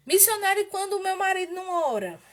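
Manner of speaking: high, loud mock complaint from a worried wife; esposa queixosa imitada. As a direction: pitch it up as a wife appealing to her missionary. Voicing a follower's complaint. (esposa se queixando, em voz alta e aguda)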